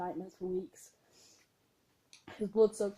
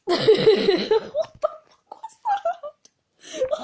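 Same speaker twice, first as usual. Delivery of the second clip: very high, loud, slow shriek of disbelief; real shock breaking into laughter; shocked squeal; 12 dB louder, much slower than usual. Real, not acted. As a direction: scream it out, stunned and laughing. Shocked at own recording.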